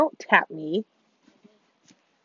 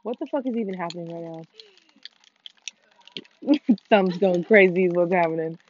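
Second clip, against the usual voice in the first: ordinary talk, nothing marked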